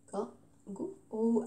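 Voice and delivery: "Kangourou" very soft, three slow separate beats; split into syllables. Shown as a demonstration. Teacher repeating the syllable count, "Kangourou" softly syllable by syllable. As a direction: say "Kangourou" quietly, kan... gou... rou. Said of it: "combien de syllabes?".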